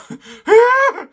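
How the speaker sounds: loud, very high acted cry; startled high squeal; high-pitched shriek. Voices character's shriek in manga.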